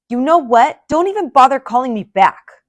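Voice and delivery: loud, high irritated shout; grumpy yell. A narrator's reading. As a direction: snap at a passerby. Grumpy grape yelling.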